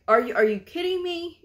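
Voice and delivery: high, stumbling disbelief; incredulous outrage. Retold or imitated. Coder jumping in at the provider, incredulous outrage. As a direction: jump in, offended, in acted retelling.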